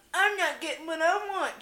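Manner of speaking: high-pitched sulky complaint; hammed-up petulant protest; mock whine. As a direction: whine it like a put-out partner. Mock complaint voiced as a woman.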